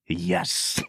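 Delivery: low, soft imitated "yes"; hushed realization. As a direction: drop to a hushed, dramatic yes. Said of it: "He goes".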